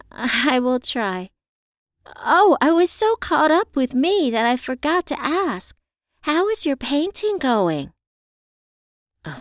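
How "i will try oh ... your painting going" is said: high, lively voice of an eager friend; grateful friend, eager. Acted. Friend asking Evie about her painting, high and eager. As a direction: perk up high, suddenly remembering to ask. Voiced character on a phone call.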